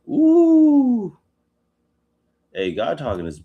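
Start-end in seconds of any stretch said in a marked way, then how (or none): 0.1-1.2 high ooh. Real, not acted. Reacting to God's sarcasm.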